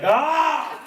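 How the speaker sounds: high, sudden mock yell; angry outburst. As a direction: let out one loud, exasperated cry. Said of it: "and then he's like".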